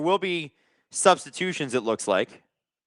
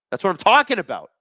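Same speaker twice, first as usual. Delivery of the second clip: higher, emphatic approval with a punch on TALKING; excited approval; 6 semitones higher, 3 dB louder than usual. Real, not acted. Streamer backs a fan's rallying message, excited approval. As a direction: cheer the fan on, pitch jumping up.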